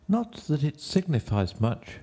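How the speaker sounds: high-pitched musing as Alice; Alice to herself. A narrator's reading. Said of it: "she said to herself".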